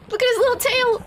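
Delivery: high, loud cooing; gushing delight. Real, not acted. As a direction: gush over something tiny. Gushing over baby animal.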